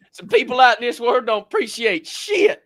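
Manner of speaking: high, loud mock Southern woman; imitated southern accent. Host quoting the woman in the clip, mock southern accent. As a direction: mimic her Southern indignation, pitched well up. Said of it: "Her southern accent too".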